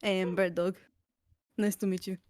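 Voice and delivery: ordinary talk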